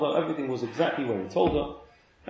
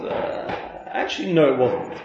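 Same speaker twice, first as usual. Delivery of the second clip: hesitant, lower sheepish admission; 4 semitones lower, slower than usual. Retold or imitated. Finder questioned about the empty purse, acting a bit sheepish. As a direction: hem and haw, imitating a sheepish man. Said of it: "The man's a bit sheepish".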